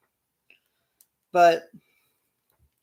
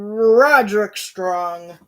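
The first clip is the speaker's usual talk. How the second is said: louder, slow, stretched announcer call; 3 semitones higher, 8 dB louder, much slower than usual. Pretend. Introducing Roderick Strong as entrant, slow announcer call. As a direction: draw out the name like a ring announcer.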